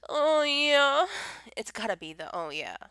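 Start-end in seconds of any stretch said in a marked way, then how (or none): 0.1-1.1 higher, louder put-on moan; drawn-out sample of a moan, pitched up and loud; mock moan. Shown as a demonstration. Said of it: "Like, what's your favorite?". 2.3-2.8 low mock moan; understated, lower-pitched sample of a moan. Shown as a demonstration. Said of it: "Like, what's your favorite?".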